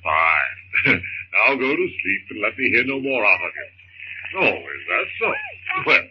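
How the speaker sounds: calm his frightened wife in a deep voice; husband's voice. Acted. John soothes baby.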